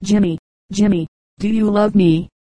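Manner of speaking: a touch of seasick pleading; mildly plaintive, lightly voiced bride; pathetic murmur. A narrator's reading. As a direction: ask weakly, a little sorry for herself. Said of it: "she murmured pathetically".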